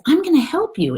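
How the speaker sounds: very high, loud little voice; helpful little soul. Acted. Another little soul offers help, high and keen. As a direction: announce your offer in a high storybook voice. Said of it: "along comes another little soul".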